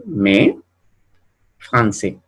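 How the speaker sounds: "me, français" with ai said eh, silent final S. Shown as a demonstration. Teacher giving French examples, AI as eh. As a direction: end "français" on an eh vowel. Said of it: "La A con la I se va a pronunciar E".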